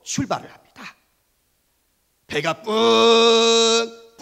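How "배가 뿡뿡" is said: drawn-out loud honking horn sound; ship horn. Acted. Storyteller imitates the ship's horn, long loud honk. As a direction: hoot like a ship leaving port, slow.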